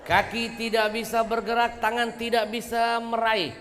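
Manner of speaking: fiery preaching. Acted. Preacher rousing the women's study group, fiery. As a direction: drive the point home to the mothers.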